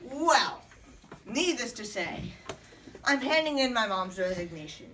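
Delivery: ordinary delivery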